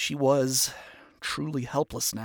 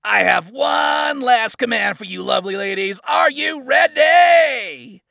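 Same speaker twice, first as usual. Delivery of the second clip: rallying shout; 10 semitones higher, 12 dB louder than usual. Acted. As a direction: fire up the troops. Commands the army.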